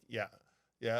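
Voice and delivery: low-key, unbothered radio reply; matter-of-fact captain. Retold or imitated. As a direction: answer like an unfazed airline captain. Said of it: "Matter of fact".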